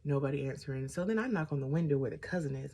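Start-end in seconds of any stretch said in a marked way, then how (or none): none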